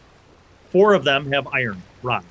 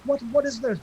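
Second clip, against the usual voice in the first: mock shouting; 11 semitones higher than usual. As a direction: call out in alarm as a high, thin crowd voice. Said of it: "they're shouting and they're like".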